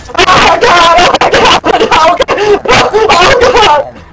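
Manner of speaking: high, loud panicked screams; screaming. Retold or imitated. Keith screaming while grabbing the snake's head, loud panicked screams. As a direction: scream as you grab the snake. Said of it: "he was screaming and bitching".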